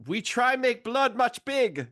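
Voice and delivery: put-on Igor accent. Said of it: "He says".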